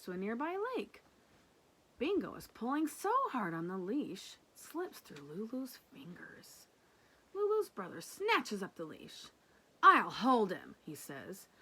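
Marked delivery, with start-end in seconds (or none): 4.5-6.7 suspense whisper. Acted. Narrating the leash slipping. 9.8-10.8 bossy brother. Acted. Brother grabs leash.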